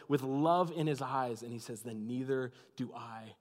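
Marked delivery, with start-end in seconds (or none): 1.8-3.4 soft, low tender pardon; loving and patient. A narrator's reading. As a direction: say it with love in your eyes. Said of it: "with patience, with joy, with love".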